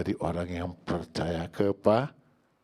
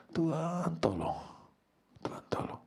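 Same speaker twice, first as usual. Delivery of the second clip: retold plea; 9 semitones higher, 6 dB quieter than usual. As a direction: re-enact a lonely cry for help. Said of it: "Saya diisolasi sendiri di kamar". (seruan minta tolong yang ditirukan)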